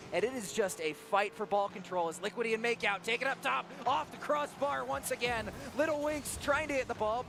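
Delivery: continuous, driving esports commentary; live play-by-play. Acted. Caster follows the fight for the ball, live play-by-play. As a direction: build to a peak on each shot.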